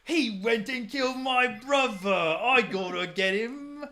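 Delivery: high, shouted mock Cockney; loud, slow Cockney caricature; annoying Cockney. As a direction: make your Cockney as annoying as possible. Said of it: "Just be the most annoying voice".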